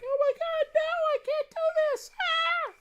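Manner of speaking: very high, loud, flustered falsetto squeal; shrieking in embarrassment; embarrassed Lucia squeal. Retold or imitated. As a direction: squeak it out like a mortified girl. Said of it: "and now she's like".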